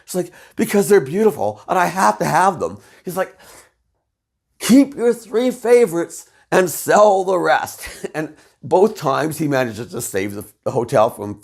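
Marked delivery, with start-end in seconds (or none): 0.5-2.8 higher, put-on voice of a spoiled rich woman; spoiled diva voice. She defends her horses to him in a spoiled diva voice. 4.6-7.9 higher, louder and slow, spelling it out; exasperated advice. Retold or imitated. He tells her to sell the horses, slow and exasperated.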